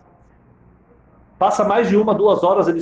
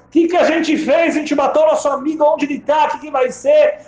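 alarm rising to a shout; panicked; 10 semitones higher than usual. Acted. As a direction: friends panicking that they killed their friend. Friends panic, Gedalia missing.

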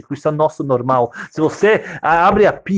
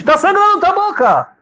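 much higher, louder, slow alarmed cry; panicked father; 4 dB louder than usual. Acted. Father alarmed at the baby's bleeding mouth, high panic. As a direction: shriek it in fright.

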